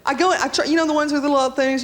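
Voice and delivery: usual speaking voice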